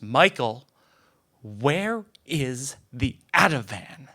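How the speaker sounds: loud on Michael, then slow and demanding; a mother's mild mock yell for her pills; retold shout. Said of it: "she shouted".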